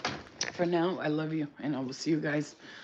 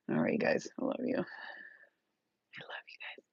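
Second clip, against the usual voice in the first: whisper; 8 dB quieter, faster than usual. Real, not acted. Whispered sign-off to viewers.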